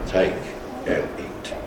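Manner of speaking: normal talking voice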